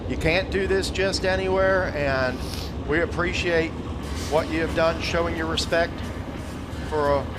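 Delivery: PA tribute to a lost friend; solemn announcer. Acted. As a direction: lift the crowd for the send-off. Announcer thanks the grandstands.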